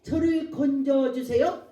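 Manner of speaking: loud, drawn-out plea to God; high, imploring prayer cry. Shown as a demonstration. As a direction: call out slowly for rescue. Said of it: "이렇게 기도하게 되는 것입니다".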